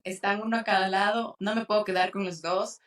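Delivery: ordinary delivery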